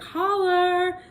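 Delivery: high, loud drawn-out call; mock holler. Deck has so much to say, playful holler. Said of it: "the Starseed deck is like".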